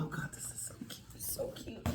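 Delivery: hushed, fast awed whisper. Real, not acted. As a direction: breathe it out in wonder. Awed by a gift.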